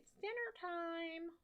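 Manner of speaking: high, slow mom calling; sing-song like a mom calling him to dinner; imitating mom. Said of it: "my mom is like".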